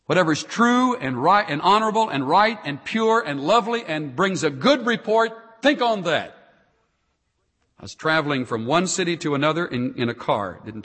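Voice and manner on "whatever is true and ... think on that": raised, ringing exhortation; preaching at full force. Acted. Pastor urging the congregation, at full preaching force. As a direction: preach it out to the whole room.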